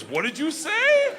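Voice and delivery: high, slow put-on Russian gangster; high slow mock Russian thug. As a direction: play the puzzled gangster, drawn out. Russian gangster asks, voiced high.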